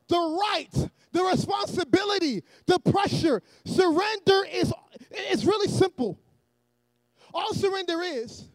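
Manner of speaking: loud, emphatic sermon delivery; forceful preacher cadence; preaching. Real, not acted. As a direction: preach it to a congregation in measured phrases. Preaches to the congregation.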